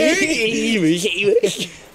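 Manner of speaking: slow put-on old man voice. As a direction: speak it like a frail old man. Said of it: "It's my old man voice".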